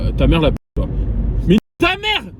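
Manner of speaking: furious shout at the other car; furious yell. Real, not acted. Cursing the driver who flashes him, in a loud furious shout.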